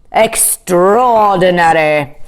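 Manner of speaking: slow, drawn-out marvel; marvelling king. A narrator's reading. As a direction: savour the word as the king.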